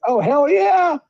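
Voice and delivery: hyped-up retold cheer; high excited "hell yeah"; mock excited cheer. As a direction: pitch jumps high with glee.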